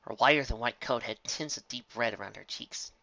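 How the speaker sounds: ordinary delivery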